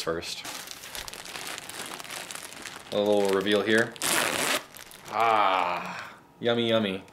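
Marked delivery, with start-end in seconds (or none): none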